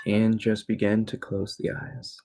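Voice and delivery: guided meditation. Real, not acted. Teacher guiding listeners into evening relaxation, calm meditation voice. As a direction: ease them gently into stillness.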